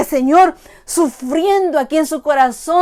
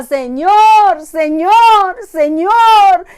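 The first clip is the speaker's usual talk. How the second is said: desperate crying plea; 4 semitones higher, 5 dB louder, slower than usual. Acted. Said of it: "cuántas veces gritaba".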